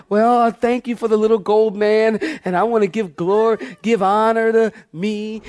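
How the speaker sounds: high breathless self-praising actress; gushing winner speech. Retold or imitated. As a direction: gush over yourself accepting the award. Mock actress acceptance speech.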